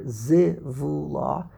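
zivula drawn out slowly as zi, vu, la; syllable by syllable. Shown as a demonstration. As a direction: spell out zivula in three slow syllables. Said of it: "how many syllables are there".

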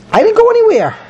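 high, loud protesting whine; indignant denial. Retold or imitated. Gehazi denying to his teacher, high and loud. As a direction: protest innocence with a squeal.

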